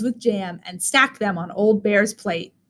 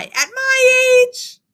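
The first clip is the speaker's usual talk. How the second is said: put-on flustered old lady; 13 semitones higher, much slower than usual. Buba blushing, flattered.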